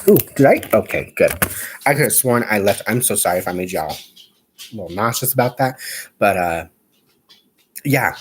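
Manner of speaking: ordinary talk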